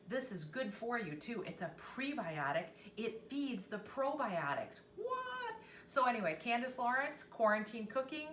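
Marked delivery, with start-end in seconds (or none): 5.0-5.6 very high, drawn-out playful "what"; mock amazement. Cook wowed by her own health fact, mock amazement. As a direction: squeal it softly in pretend disbelief.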